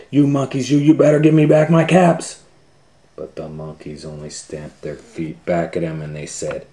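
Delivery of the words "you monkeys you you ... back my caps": loud, high, furious demand; angry shout. A narrator's reading. Peddler stomps his feet and demands his caps, angry shout. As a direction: yell at them to give the caps back. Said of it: "now he felt quite angry".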